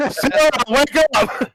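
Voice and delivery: high, slow, very loud yell; shouting. Acted. Player trying to rouse unconscious Sarah, shouting. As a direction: yell to wake someone out cold.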